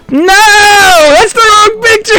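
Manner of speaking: high, loud laughing yell of dismay; real panicked shout at the wrong picture, laughing. Real, not acted.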